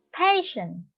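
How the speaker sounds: "Passion" high on the first syllable, then falling; first-syllable stress. Shown as a demonstration. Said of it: "stress on the first syllable".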